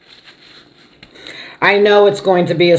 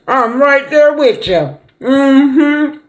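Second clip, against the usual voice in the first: much higher and slow, beaming "I'm right there with you"; eager agreement; 9 semitones higher, much slower than usual. Real, not acted. Mom eagerly accepting her son's Super Bowl invite, high and delighted. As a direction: lift it high and drawn out, delighted to be asked.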